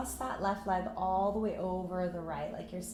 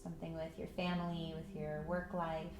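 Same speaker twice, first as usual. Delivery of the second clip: calm meditation voice with long pauses; unhurried, even guidance into stillness; guided meditation; 5 dB quieter than usual. Acted. Yoga teacher settling students into breath and patience, guided meditation.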